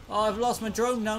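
normal talking voice

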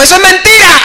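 high, loud outburst; mock heckler shout. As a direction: imitate a heckler crying out from the pews. Drunk heckler interrupts sermon, retold.

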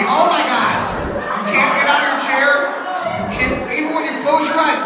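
mock horrified laughter. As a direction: squeal at grandparents in Speedos. Acted scared reaction at grandparents.

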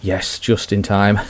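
normal talking voice

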